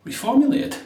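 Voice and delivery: high, shocked squeak of disbelief; outraged echo of the word; indignant disbelief. Retold or imitated. Shop assistant's indignant reply.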